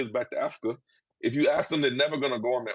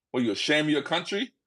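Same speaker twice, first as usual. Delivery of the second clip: ordinary talk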